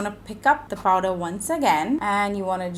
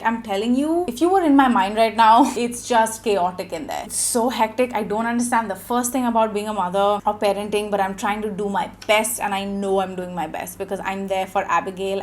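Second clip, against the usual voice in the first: ordinary talk, nothing marked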